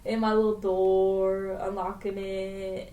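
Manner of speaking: slow, drawn-out teasing delivery; taking my time. Retold or imitated. Friend unlocking the door at leisure, dawdling. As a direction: stretch each phrase out unhurriedly. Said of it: "I was here taking my time".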